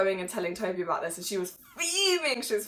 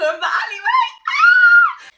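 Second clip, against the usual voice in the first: loud, high laughing scream; laughing shriek; 12 dB louder than usual. Real, not acted. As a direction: shriek with delight. Laughing shout.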